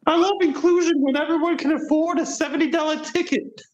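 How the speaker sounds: high squeaky cartoon mouse voice; Steamboat Willie voice. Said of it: "Steamboat Willie".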